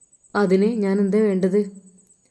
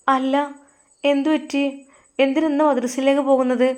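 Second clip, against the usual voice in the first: put-on higher, curious young wife; wife asking; 6 semitones higher than usual. Wife asks husband why he goes to madrasa, higher voice. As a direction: ask him sweetly, a pitch up. Wife's voice in story.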